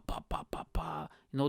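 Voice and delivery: ordinary talk, nothing marked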